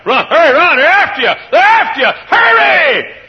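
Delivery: high, loud warning cry; urgent shout. Acted. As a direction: yell at them to run for their lives. Calling fugitives to run.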